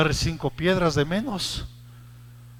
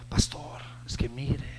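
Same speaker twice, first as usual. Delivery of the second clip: whispered, soft "Pastor, es que mire"; hesitant, almost ashamed; timid whisper; 3 semitones lower, 7 dB quieter, slower than usual. Retold or imitated. Young man whispering to his pastor for advice. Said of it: "imagínense la escena".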